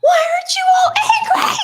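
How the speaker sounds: high, hammed-up shout; comically unhinged high-pitched yell; exasperated outburst. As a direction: yell it in mock outrage, voice way up. Acts out outburst at calm passengers.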